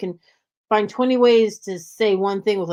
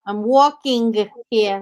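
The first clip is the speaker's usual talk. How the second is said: high, slow Jersey accent demo; exaggerated Jersey accent, pitched up; thick New Jersey accent; 4 semitones higher, 3 dB louder, slower than usual. Shown as a demonstration. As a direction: stretch the vowels like a thick Jersey talker. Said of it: "train me to not say Inga".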